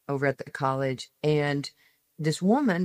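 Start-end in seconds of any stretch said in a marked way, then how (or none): none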